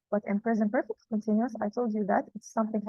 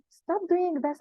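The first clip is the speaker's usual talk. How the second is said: acted anger; 7 semitones higher, slower than usual. Shown as a demonstration. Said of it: "intonation to express anger".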